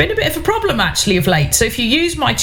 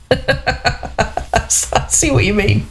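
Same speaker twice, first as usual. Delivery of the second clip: laughing; much slower than usual. Real, not acted. Laughs at the listener's joke.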